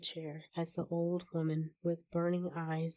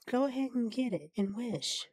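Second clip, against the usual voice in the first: higher-pitched, excited urging; mildly quivering excitement; 5 semitones higher than usual. A narrator's reading. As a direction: urge them on eagerly, pitched up. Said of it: "quivering with excitement".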